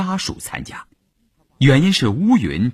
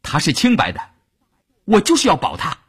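raised, high-pitched insistence; loud defiance; 11 semitones higher, faster than usual. A narrator's reading. Wife defending her husband at the meeting, loud. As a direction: stand up for him, voice climbing high. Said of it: "乌云也大声地说".

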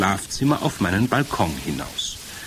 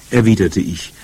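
ordinary delivery

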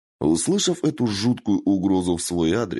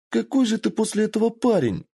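put-on squeaky fox girl voice, much higher; high taunt. A narrator's reading. Kitsune taunting Michiyo in a high squeal.

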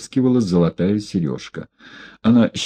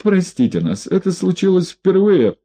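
high, tearful begging; whiny pleading; 10 semitones higher than usual. A narrator's reading. Одутловатый плаксиво умоляет Егорова о прощении. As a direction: grovel in a whining voice. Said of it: "плаксивым голосом одутловатый".

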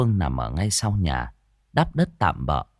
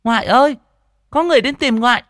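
put-on very high, loud boy's shout; child yelling; 7 dB louder, faster than usual. A narrator's reading. Boy announces visitors to grandmother, high-pitched yell. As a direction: call out to grandma through the house. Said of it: "hét lên".

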